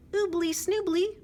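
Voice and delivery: high, loud singsong nonsense; bouncy high chirpy rhyme; silly bird song. Acted. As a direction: chirp it out gleefully. Dove tries silly song.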